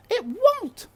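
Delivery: very high, loud, slow wail of a distraught cook; high loud cook's lament; shouting cook. A narrator's reading. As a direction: cry it out like a frantic old cook. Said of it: "she said".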